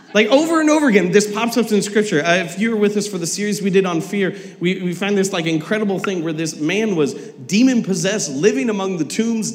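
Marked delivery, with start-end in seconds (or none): none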